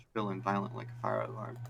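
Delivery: ordinary talk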